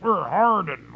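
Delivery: a hint of a macho caricature; exaggerated tough guy. Over-exaggerating the hardened side's tough voice. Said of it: "that's not how I sound".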